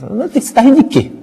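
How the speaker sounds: high-pitched demanding question; acted insistent wife. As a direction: imitate a wife pressing her husband for answers. Wife's acted quarrel with husband.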